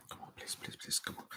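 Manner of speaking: desperate whispered please; pleading whisper. Real, not acted. Tense fan whispering pleas for a goal, barely audible. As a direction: beg under your breath.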